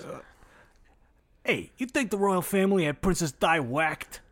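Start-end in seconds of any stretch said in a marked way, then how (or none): 1.4-4.2 louder, higher caricature mobster; sketch-show mob guy. Acted. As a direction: do a cartoonish mobster. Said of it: "that's like an S N L f-, f-, mob guy".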